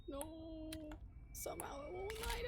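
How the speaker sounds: breathless scared whisper. Real, not acted. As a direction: whisper it, tense and hushed. Nervous whisper in horror game.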